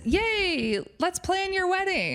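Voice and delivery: high, bright squeal of mock delight; gushing excitement. Friend gushing over his wedding plans, squealing. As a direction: gush like she's thrilled for him. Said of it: "a crazy line she does".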